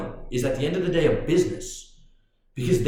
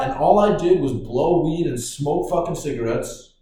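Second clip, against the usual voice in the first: ordinary talk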